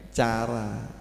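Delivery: ordinary talk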